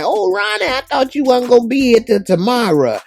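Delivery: very high, put-on old lady voice; excited old lady. Miss Ethel greets Ronnie coming home, high delighted old lady voice. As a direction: gush over him like a delighted grandma. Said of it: "She said".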